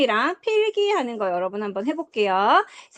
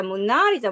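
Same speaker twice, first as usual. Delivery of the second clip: "모나리자"의 "나"에 강세; "모나리자"에서 "나"를 힘주어. Shown as a demonstration. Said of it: "이렇게 연결해서 할 수 있겠어". ("모나리자"의 "나"를 세게)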